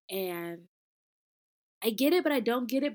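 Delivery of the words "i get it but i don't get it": louder, faster, pitched up; tumbling out in an exasperated rush; exasperated and excited. Shown as a demonstration. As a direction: rattle it off in frustration. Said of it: "but I get it, if that makes sense".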